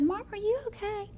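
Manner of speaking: a hint of a worried daughter asking; concerned daughter. Daughter voiced in the story.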